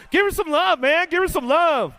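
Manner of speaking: hyping the crowd. Real, not acted. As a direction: pump the crowd up for her.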